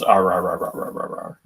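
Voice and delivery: gruff growling rah rah into a radio; mock bear grumbling; bear noises. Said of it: "Radio Bear just goes".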